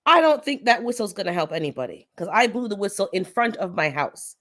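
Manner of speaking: normal talking voice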